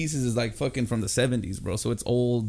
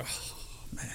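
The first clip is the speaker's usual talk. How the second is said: whisper; 18 dB quieter than usual. Retold or imitated. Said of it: "You're like".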